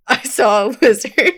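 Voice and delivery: trying not to laugh; fighting back a giggle; stifled giggles. Shown as a demonstration. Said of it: "how many takes it took".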